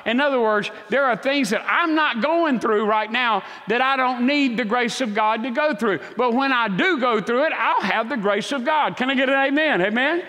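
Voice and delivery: high, loud preaching build; preaching at full force. Acted. Preacher urging the congregation not to worry, at full force. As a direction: preach it with rising fire.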